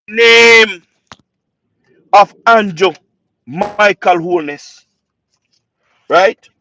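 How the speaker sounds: loud, proud shout of a name; a fervent supporter shouting the leader's name. Real, not acted. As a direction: shout his name out with pride. Passionate praise of prime minister.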